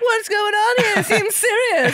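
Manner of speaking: high, loud mock-serious voice; high mock concern. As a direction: squeak it out with fake concern. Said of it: "And we're like".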